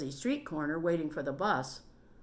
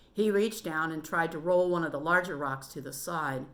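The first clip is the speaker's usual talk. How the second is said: ordinary delivery, nothing marked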